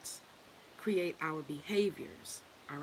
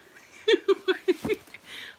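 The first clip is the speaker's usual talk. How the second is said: a burst of high, loud laughter; 11 dB louder than usual. Real, not acted. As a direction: crack up loudly. Laughs at her own imitation.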